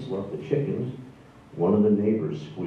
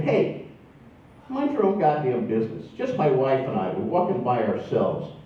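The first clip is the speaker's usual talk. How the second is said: snapping back; 5 semitones higher than usual. Retold or imitated. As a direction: lose your temper and tell him off. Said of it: "And I just kinda snapped".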